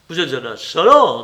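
plus fort, un courage qui se force; colère forcée. Acted. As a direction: se fait violence pour lancer l'insulte. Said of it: "dit-il en se faisant violence". (colère contenue et forcée, plus fort)

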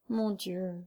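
understated grieving plea; moaning lament. A narrator's reading. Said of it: "gémit la pauvre femme".

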